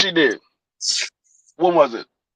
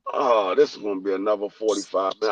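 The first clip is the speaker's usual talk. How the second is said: muttered aside; 11 semitones lower, 11 dB quieter, faster than usual. Retold or imitated. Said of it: "I'm like".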